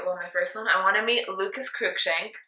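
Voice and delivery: ordinary talk, nothing marked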